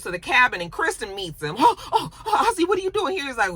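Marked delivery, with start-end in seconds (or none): 1.6-3.2 high, flustered oh-oh-oh; imitating her high-pitched alarm; mock startled surprise. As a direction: act caught off guard by a visitor. Acted surprised greeting at cabin.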